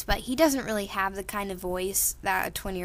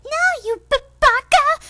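squeaky high flustered shriek, much louder; flustered anime girl; 12 dB louder than usual. Acted. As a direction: shriek it like a blushing tsundere. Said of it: "Kinda like that".

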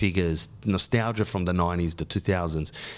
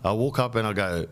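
ordinary talk, nothing marked

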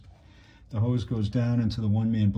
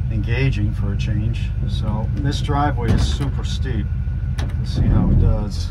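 in-car recording; 9 dB louder than usual. Real, not acted. Driving test, recorded in moving car.

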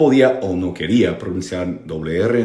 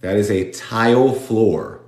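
slow model reading; slower than usual. Shown as a demonstration. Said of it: "these are tiles".

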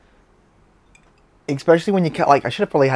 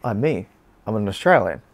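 ordinary talk, nothing marked

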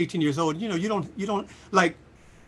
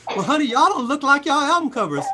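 higher, louder sassy retort; mock mad fan; 10 semitones higher, 7 dB louder than usual. Upset fan telling off the band, higher and louder. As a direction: give it back with attitude. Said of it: "she got all mad".

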